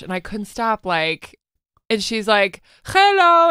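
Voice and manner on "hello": high, loud drawn-out greeting; mimicked high-pitched hello; imitated cleaning lady. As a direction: call it out as the cleaning lady, higher and louder. Retelling cleaning lady's greeting.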